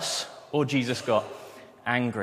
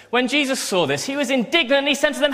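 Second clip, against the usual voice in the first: much higher, louder and quicker, rising to indignation; building indignant reading; building urgency; 12 semitones higher, 6 dB louder than usual. Shown as a demonstration. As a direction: read it with mounting heat, as if Jesus is about to explode. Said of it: "So I think it was probably a bit more like this".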